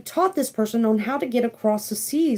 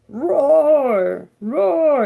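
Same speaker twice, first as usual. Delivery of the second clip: louder, drawn-out imitation roar; lion roar; 3 semitones higher, 5 dB louder than usual. Card reader acts the lion calling its ancestors, mock roar.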